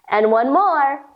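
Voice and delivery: normal talking voice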